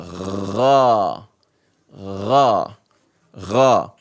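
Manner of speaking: ra low, gargled in the throat. Shown as a demonstration. Teacher demonstrating the gargled ra. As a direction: gargle the ra deep. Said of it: "Same thing with this letter".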